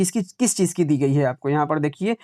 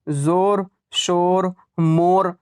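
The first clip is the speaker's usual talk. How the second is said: ordinary talk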